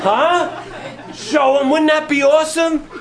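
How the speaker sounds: preacher gleefully imagining the reveal; laughing with glee. Real, not acted. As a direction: laugh it out, thrilled. Imagining triumph over scoffers.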